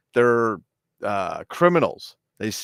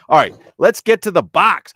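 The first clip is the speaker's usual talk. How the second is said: high, loud hype shout; mock-frantic rallying yell; hammed-up announcement; 10 semitones higher, 12 dB louder than usual. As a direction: belt it out like a segment kickoff. Hyped segment call, then just kidding.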